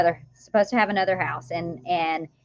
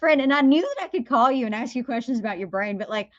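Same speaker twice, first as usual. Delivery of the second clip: excited best friend; 6 semitones higher than usual. Retold or imitated. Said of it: "She goes".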